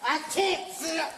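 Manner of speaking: real distress while restrained; distressed protest. Real, not acted. Handcuffed man protests officers.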